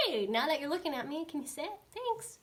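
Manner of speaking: high-pitched, friendly trainer voice; light singsong request to a pet; sweet dog cue. Shown as a demonstration. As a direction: ask the dog nicely, ending very high. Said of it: "You can say".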